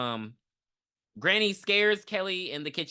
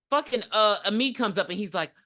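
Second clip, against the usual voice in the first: ordinary delivery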